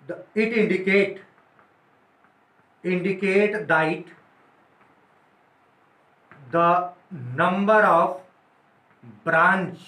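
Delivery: word by word, very slow, pausing for notes; slow dictation. Shown as a demonstration. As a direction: dictate so students can write it down. Teacher dictating notes slowly. (धीमे-धीमे लिखवाते हुए)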